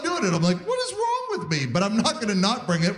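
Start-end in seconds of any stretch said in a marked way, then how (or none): 0.7-1.7 high, strained mock exasperation; pitched-up disbelieving inner voice; exasperated at himself. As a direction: squeak out a comic groan at yourself. Comic self-exasperated inner voice.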